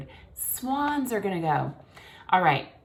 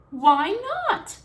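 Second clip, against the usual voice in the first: high, louder puzzled cry; cygnets cry out; 14 semitones higher, 6 dB louder than usual. A narrator's reading. Cygnets protesting to their father, crying high. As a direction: squeal it like little swans. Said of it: "cried all the cygnets".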